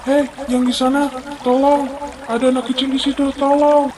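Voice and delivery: high, loud desperate calls for help; shouting for help. Acted. Stranger calling out to whoever is there, shouting for help. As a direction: yell across the water for someone to come.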